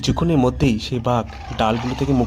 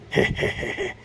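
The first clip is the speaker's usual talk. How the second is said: breathy, wheezy villain's cackle; husky evil snicker to oneself; sly beastly he-he-he; tiger's gloating laugh; 4 dB quieter than usual. Acted.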